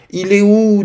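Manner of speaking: put-on high, loud child's question; puzzled child. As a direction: ask it high, like a confused little one. Petite Azura demande, perdue. (petite voix aiguë, forte, d'enfant perdu)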